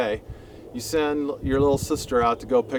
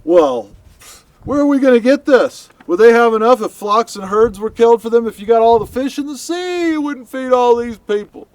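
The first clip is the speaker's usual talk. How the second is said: very high, loud disbelieving outcry; incredulous protest; 10 semitones higher, 8 dB louder than usual. Acted. Moses to God, protesting the meat promise in disbelief. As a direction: shout it in disbelief at the impossible. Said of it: "Moses' response is".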